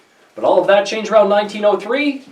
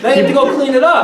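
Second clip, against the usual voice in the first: teasing mock order, pitched higher; joking order; 6 semitones higher, 3 dB louder than usual. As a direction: tease with a pretend telling-off. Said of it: "I'm joking".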